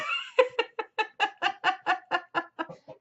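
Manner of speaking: high, real burst of laughter; long laugh. Real, not acted. Vlogger laughs at being such a sap, long laugh. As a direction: laugh helplessly in a long run. Laughing at her own sappiness.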